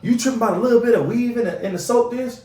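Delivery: brushing off a fuss over weave in the soap dish; mocking retort. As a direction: toss it off like it's ridiculous. His own retold retort.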